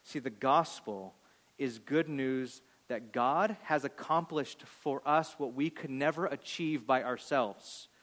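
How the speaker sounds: ordinary delivery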